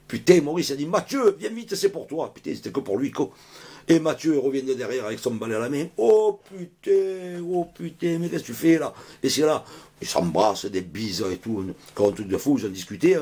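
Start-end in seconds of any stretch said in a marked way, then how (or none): 0.9-2.3 high, pressing call to come; urgent call. Acted. Maurice calls Mathieu urgently. 6.0-8.9 high, very slow drawn-out cry of joy; happy reunion cry; amazed greeting. Acted. Obama greets Mathieu with amazement.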